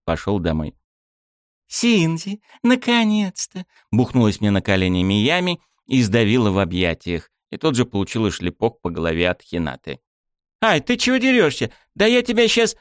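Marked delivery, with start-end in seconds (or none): 1.7-3.6 put-on high, slow, gleeful girl's greeting; drawn-out happy cry of a young girl; cheerful girl voice. Girl greets him joyfully. 10.6-12.8 put-on high, squeaky protest of a girl; a girl yelping and threatening back; indignant girl voice. Girl hit on the head.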